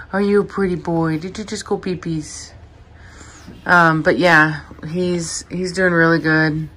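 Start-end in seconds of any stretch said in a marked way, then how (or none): 0.1-2.5 cooing pet voice. Real, not acted. Owner cooing at her puppy, baby talk.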